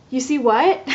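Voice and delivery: acted-out double take; stunned disbelief. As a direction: react as if you misheard startling news. Acted shocked reply to sonographer's news.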